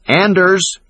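high, loud call for help; shouting a name. Acted. Trapped woman shouting for help, loud. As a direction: yell Anders across the basement. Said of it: "she shouted".

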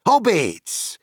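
high exclamation of recognition; sudden cry. A narrator's reading. Landlord realising his guests are hobbits, cried out. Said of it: "he cried".

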